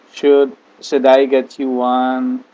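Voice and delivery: Should I get you one said slowly and carefully; slow model sentence. Shown as a demonstration. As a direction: spell it out slowly for the class. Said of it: "teacher models the English sentence". (ucapkan pelan dan jelas untuk murid)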